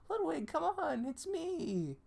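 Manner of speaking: a desperate, squeaky plea; pleading high voice. Acted.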